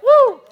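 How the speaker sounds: loud, high joyful whoop. Real, not acted. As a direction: let out a big happy whoop. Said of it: "And I'm so happy".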